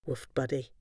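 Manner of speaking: plain reading voice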